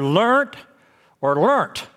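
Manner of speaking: "learnt" as one syllable ending in a hard T; one-syllable pronunciation. Shown as a demonstration. Preacher offers regional ways to say it, one-syllable "learnt". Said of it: "depending on what part of the country".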